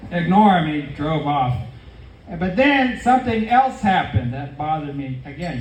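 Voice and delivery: ordinary delivery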